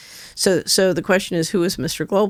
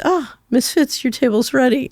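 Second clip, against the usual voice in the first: lilting, much higher welcome to a regular; sweet high maitre d' greeting; 7 semitones higher than usual. Retold or imitated. Hostess warmly greets her favored regular, high and bright. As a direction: sing it out like a pleased hostess.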